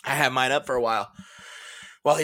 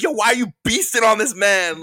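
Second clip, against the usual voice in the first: incredulous; 11 semitones higher, 7 dB louder than usual. Retold or imitated. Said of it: "I was like".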